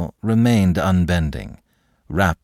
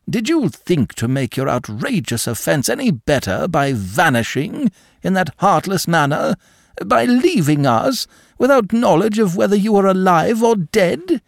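indignant reproach; 10 semitones higher than usual. Acted. Seigneur reproaching his godson.

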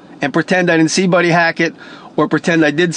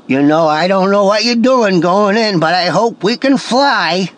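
put-on East Coast old-school comedian as a cute bird; hammed-up comedian impression, words stretched; Buddy Hackett; 2 semitones higher than usual. Shown as a demonstration. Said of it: "I was gonna do Buddy Hackett's voice".

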